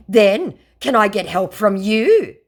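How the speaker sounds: loud, high mock plea; hammed-up begging. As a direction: whine it like a desperate scammer. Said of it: "he asks, finally just getting desperate and begging".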